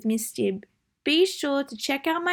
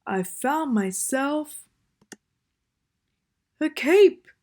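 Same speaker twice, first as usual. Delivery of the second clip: Bear's voice; 4 semitones higher, 4 dB louder than usual. Acted. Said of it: "said Bear".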